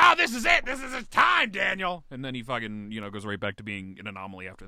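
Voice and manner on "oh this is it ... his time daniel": high, loud gushing over-excitement; mock hype. Fans gushing over one good Sanheim game, high and loud mock hype. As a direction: gush like an overhyped fan, ramping it up. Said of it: "and everybody's like".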